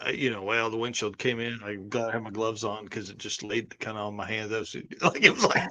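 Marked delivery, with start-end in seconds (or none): 0.4-4.5 quiet, unbothered monotone retelling; deadpan even tone. Retold or imitated. Josh shrugging off the windshield, flat and quiet. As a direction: tell it like nothing happened, softer. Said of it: "just very even tone".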